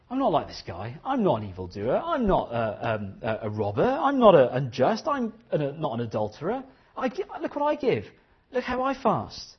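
a self-satisfied Pharisee showing off; boastful Pharisee. Acted. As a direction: puff yourself up, listing your virtues.

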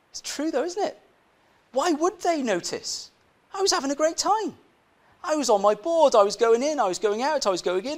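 animated, high-pitched arguing; high-pitched protest. Acted. Preacher conceding his friends' point to the congregation, high exasperated protest. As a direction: protest it high, rattling through the reasons.

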